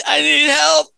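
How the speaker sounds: mock pitiful cry; plaintive plea. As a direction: beg for help from behind the door. Customer calls again.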